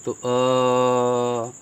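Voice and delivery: अ held very long and steady; drawn-out vowel. Shown as a demonstration. Teacher holding out अ for the class, very long. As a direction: hold the अ sound out long. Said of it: "जैसे हम अ बोल रहे हैं".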